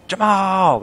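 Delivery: shouting for Jamal across the room; calling out. Acted.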